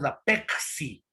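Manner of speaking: "Pepsi" with the p turned into a c, like Pecsi; coastal Pepsi. Shown as a demonstration. Teacher shows the class the coastal Pepsi, Pecsi. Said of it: "desaparece la letra P, aparece, eh, la letra C".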